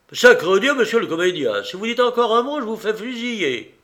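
high, loud captain's outburst; worked-up angry rant; furious threat. A narrator's reading. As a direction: swear and threaten the passenger. Captain threatens to shoot the passenger.

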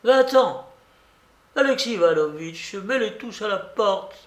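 put-on high, imperious old-lady order; angry dismissal. A narrator's reading. Babouchka orders everyone thrown out. (babouchka qui chasse tout le monde)

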